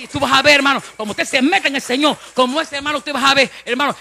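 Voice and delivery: loud fervent pulpit delivery; full-force preaching. Real, not acted. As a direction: preach it out to the congregation. Predicación a toda voz. (predicación a todo pulmón)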